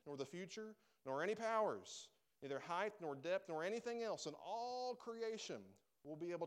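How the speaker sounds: emphatic scripture list, each item punched; preaching scripture. Acted. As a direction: build through the list to a drawn-out finish. Preacher recites scripture with force.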